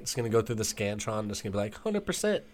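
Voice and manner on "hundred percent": high, bright robotic announcement; mock machine voice. Imitating Scantron machine.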